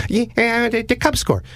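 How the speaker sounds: high, sing-song creepy caller voice; odd prank caller. Retold or imitated. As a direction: play the weird caller way up high. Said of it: "the voice at the other end says".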